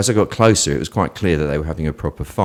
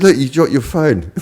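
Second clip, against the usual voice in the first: Peter Falk as Columbo; 7 semitones higher, 4 dB louder than usual. Retold or imitated. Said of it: "like a sort of doddery old guy".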